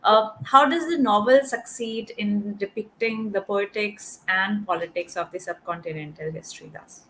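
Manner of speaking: ordinary delivery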